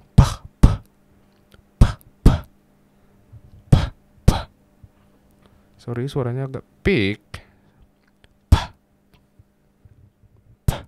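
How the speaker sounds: hard unvoiced puffs of breath into the mic; plosive pops. Shown as a demonstration. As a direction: blow hard pops to test the pop filter. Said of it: "pop filter gitu".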